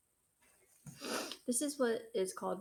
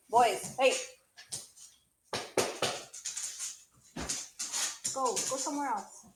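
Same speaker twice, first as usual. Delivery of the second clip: real shout, higher and louder; shouting at the dogs; 5 semitones higher, 6 dB louder than usual. Real, not acted. Reader shouts at her fighting dogs to go away, real loud. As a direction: yell the dogs out of the room. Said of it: "my dogs are fighting".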